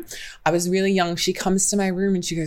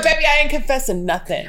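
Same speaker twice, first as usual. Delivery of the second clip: sassy refusal; 8 semitones higher than usual. Retold or imitated. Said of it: "I was like".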